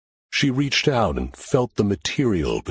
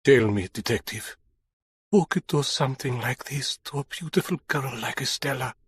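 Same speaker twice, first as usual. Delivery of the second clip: low shaky voice; 5 semitones higher, 5 dB quieter than usual. Acted. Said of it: "He said in a low, shaky voice".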